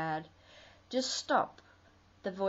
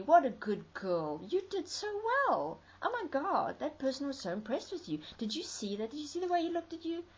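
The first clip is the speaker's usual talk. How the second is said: much higher, fast, breathless praise; gushing inner voice; 7 semitones higher than usual. Retold or imitated. The voice in her head praising her, gushing high and quick. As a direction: gush like a flattering cheerleader. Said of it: "The next day it's saying to me".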